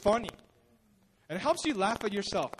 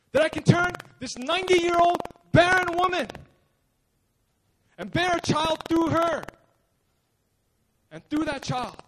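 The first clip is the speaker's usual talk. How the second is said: rising, louder declaration building to a hush; full-force preaching; 5 semitones higher, 4 dB louder than usual. Acted. Preacher voicing God's word to the crowd, building. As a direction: proclaim it with growing fire.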